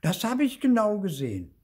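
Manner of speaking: high, loud insistence; piping, accusing little voice; Zipfel. A narrator's reading. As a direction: insist as a small accusing ghost. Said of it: "sagt Zipfel".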